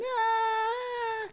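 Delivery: high mock shriek; a short panicked yelp, played back; retelling a friend's scream during an arrest; imitated scream. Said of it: "Moni is screaming".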